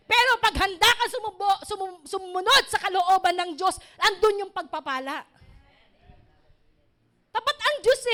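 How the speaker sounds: rally the brothers and sisters; earnest sermon climax on God's faithfulness; fervent preaching. Real, not acted. Preaching at full force.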